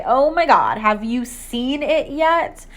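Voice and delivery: slow, breathless excitement, stretched out; excited, slow gush. A narrator's reading. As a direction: sister can hardly hold the news in. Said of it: "she cried".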